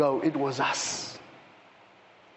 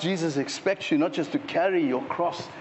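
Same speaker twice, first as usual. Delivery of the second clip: ordinary talk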